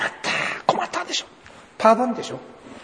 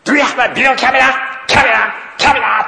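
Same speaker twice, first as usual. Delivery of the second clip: higher, much louder, insisting on キャメラ; loud angry question; 10 semitones higher, 10 dB louder than usual. Retold or imitated. Customs officer loses patience with the traveller, loud angry repeat. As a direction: shout it at someone who won't understand. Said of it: "大きな声で言ったんです".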